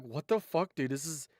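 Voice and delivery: normal talking voice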